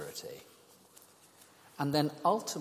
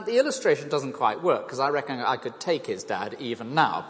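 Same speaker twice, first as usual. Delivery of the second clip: ordinary talk, nothing marked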